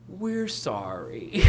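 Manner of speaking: mock apology. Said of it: "isn't that what they do in that B P apology".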